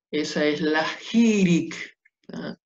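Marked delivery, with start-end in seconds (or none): none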